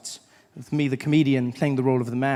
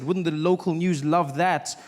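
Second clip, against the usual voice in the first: usual speaking voice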